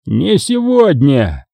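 slow, drawn-out surly reply; grunted retort. A narrator's reading. Traitor answering the priest's prayer, grunted retort. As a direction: grumble it back, stretching the words. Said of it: "буркнул отступник".

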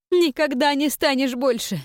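high, loud, quavering demand. A narrator's reading. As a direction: raise your shaking voice. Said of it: "Повышая дрожащий голос".

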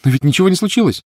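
put-on high, loud carefree little girl; laughing reply. A narrator's reading. Lily answering her worried sister, light-hearted. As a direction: brush it off in a bright child's pitch. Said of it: "рассмеялась в ответ Лили".

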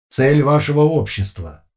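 slow, loud flat machine voice; no pauses, no feeling; emotionless metallic voice. A narrator's reading. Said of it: "совершенно без пауз и эмоций".

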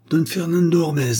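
high-pitched, incredulous repeat; challenging echo. A narrator's reading. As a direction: throw the name back at him. Cordonnier challenges the name.

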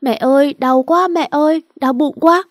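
high, loud crying wail; wailing in pain. Acted. Tâm crying to Trung's mother, high loud wail. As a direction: cry out to mother as if hurting. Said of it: "Tiếng Tâm khóc giống lên".

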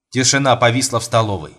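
ordinary delivery, nothing marked

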